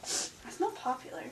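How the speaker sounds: breathy whispered retort; whispered comeback. Acted. Nerd whispering a comeback at the mean girl. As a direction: hiss it back under your breath.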